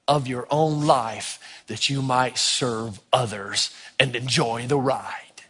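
slow, low appeal; hushed solemn close. Real, not acted. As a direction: wind down slowly and let it land.

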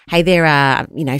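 ordinary talk, nothing marked

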